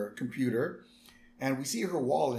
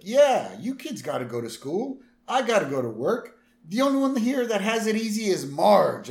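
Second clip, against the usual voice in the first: louder, higher Homer, blustering; 9 semitones higher, 8 dB louder than usual. Retold or imitated. Homer boasting to the kids at breakfast, loud and high. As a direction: lord it over the kids as Homer. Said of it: "And Homer's like".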